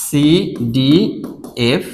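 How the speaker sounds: CDF spelled very slowly, letter by letter; slow spelling out. Shown as a demonstration. Teacher to students, spelling the rhyme scheme very slowly. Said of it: "এইটা মিল রয়েছে".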